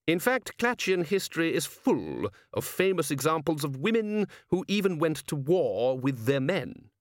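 lightly voiced patrician, explaining at leisure; learned patrician. Patrician informing his men about Clatchian history, measured lecture.

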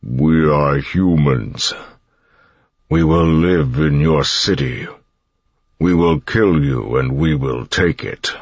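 slow, strained monster voice; words forced through stretched lips; Koloss voice. A narrator's reading. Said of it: "through lips that were stretched too tightly".

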